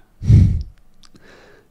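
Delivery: a hushed little laugh; whispered laugh. Real, not acted. Laughs at own remark. (petit rire chuchoté)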